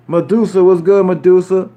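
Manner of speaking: ordinary delivery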